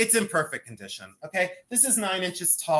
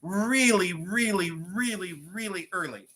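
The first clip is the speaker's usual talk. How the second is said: really, really, fading; emphatic repetition; 3 semitones higher than usual. Real, not acted. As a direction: stress each really, trailing off. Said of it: "repeating for emphasis".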